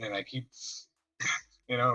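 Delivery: ordinary talk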